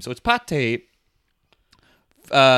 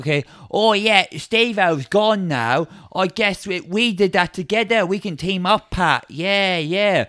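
Betty; 3 semitones higher than usual. Acted. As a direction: play an eager would-be partner, pitched up a little. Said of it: "She's like".

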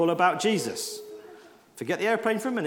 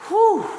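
relieved whew; 13 semitones higher, 7 dB louder than usual. Real, not acted. Relief after a messy moment.

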